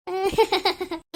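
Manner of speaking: acted cackle like a ghoul; spooky giggling burst; ghost-like laugh. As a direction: cackle like a little demon. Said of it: "ஏண்டி பேய் மாதிரி சிரிக்கிற?".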